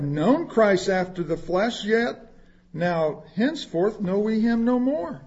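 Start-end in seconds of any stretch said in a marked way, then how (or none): none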